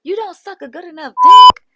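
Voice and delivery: loud, high clapback yell; hammed-up loud comeback; mock Anna yelling. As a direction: yell the insult, laughing through the repeat. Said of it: "And Anna's like".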